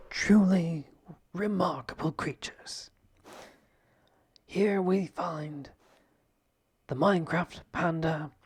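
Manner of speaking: hushed nature documentary narration. Acted. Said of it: "National Geographic".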